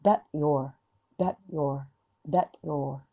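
"That your" with the t let go, no release; dropped t. Shown as a demonstration. Teacher showing students the dropped t, soft and low. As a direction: glide "that your" without popping the t. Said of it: "もうそのまま外しちゃう".

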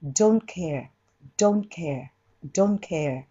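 "Don't care" with the T in "Don't" unreleased; tongue stays on the N of "Don't", straight into "care"; T left unreleased. Shown as a demonstration. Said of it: "Tは弾かない。". (「Don't」のTを弾かずに「care」へ)

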